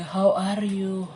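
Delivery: slow, loud, high "how are you" greeting; mock English greeting. Teacher mimics a stiff English greeting, slow and loud. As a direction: ham up the textbook greeting. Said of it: "How, how?".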